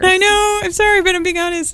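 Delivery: high, half-tearful apology; plaintive. Real, not acted. Guest owning up after tears joke, high plaintive. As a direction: apologise in a high, pleading pitch. Joked about breaking down in tears.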